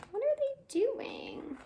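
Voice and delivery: usual speaking voice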